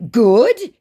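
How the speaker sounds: high, loud put-on approval; scammer's put-on voice. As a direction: pitch it up as the scammer. Acts scammer's reply.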